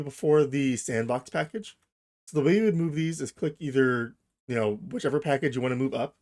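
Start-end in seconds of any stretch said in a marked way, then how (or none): none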